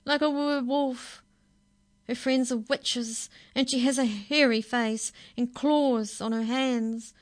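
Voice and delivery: put-on girl sobbing about her scary grandmother; tearful wail. A narrator's reading. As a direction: cry it out in fear. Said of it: "Stella Rose sobbed".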